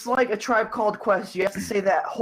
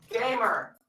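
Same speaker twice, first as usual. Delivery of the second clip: distant, softer, higher-pitched word; away from the mic; 5 semitones higher, 5 dB quieter than usual. Shown as a demonstration. Joker contrasting two kinds of gamer, said away from the mic. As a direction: call it from back away from the microphone. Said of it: "Walked away from the mic as a joke".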